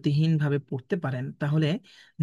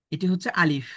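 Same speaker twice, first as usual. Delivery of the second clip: ordinary delivery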